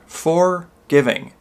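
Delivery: "forgiving" slowly, broken into FOR and GIVING; split into for, giving. Shown as a demonstration. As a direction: pause after the "for" in "forgiving". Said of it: "exact meaning of forgiveness".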